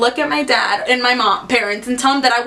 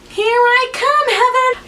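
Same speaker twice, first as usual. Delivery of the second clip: mock doomed; 11 semitones higher than usual. Dread while the phone rings.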